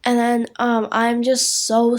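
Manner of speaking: usual speaking voice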